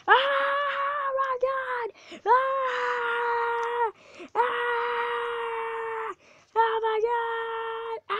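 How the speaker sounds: high panicked screams; terrified gibbering. Real, not acted. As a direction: scream in real terror. Coaster plunge, screaming.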